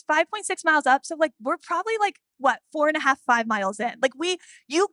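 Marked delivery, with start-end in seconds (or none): none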